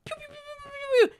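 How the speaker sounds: very high squeaky pew-pew laser sounds; laser noises. Acted. Gamer acting out the boss fight, squeaky laser sounds. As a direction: fire imaginary lasers with your mouth.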